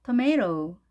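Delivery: American tomato, tuh-MAY-roh; American style. Shown as a demonstration. Teacher contrasting two options, American tomato as tuh-MAY-roh. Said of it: "두 가지 다 가능하겠죠".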